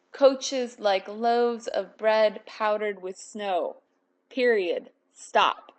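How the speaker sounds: slow, measured poem reading with a firm stop at "stop". Shown as a demonstration. Teacher reads the poem aloud to the class, slowly and deliberately. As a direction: read "coaches like loaves of bread powdered with snow" slowly, each word given space. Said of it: "That slows the, the poem down".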